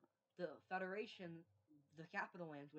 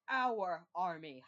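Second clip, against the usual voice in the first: slow, much louder retort; 12 dB louder, much slower than usual. Acted. Nouvelle France answering the president with a slow, booming retort. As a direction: drag it out and punch it.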